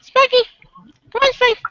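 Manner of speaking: high sing-song dog call. Real, not acted. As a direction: coax the pet in a squeaky voice. Calling the family dog.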